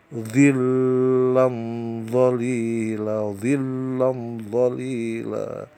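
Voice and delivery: "Zillam zalila" slow, nasal m hummed before "zalila"; Quran recitation. Shown as a demonstration. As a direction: chant "zillam zalila" drawn out. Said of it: "Cara bacanya ya". (lantunkan "zillam zalila" pelan dengan dengung)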